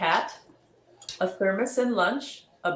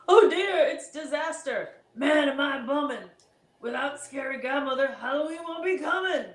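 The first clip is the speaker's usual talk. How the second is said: frantic monster voice; 6 semitones higher than usual. Acted. Monsters fretting in a panic.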